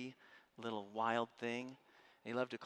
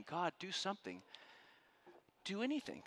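desperate prayer; 6 semitones higher than usual. Retold or imitated.

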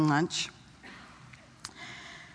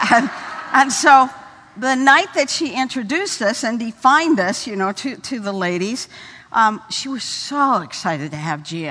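normal talking voice

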